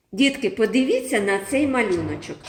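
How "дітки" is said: ordinary talk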